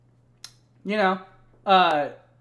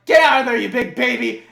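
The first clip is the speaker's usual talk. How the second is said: much louder, higher scolding shout; mock yell; 6 semitones higher, 10 dB louder than usual. Nikolai yelling at his drunk self, mock yell. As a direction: yell at him to get out. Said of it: "And he's like".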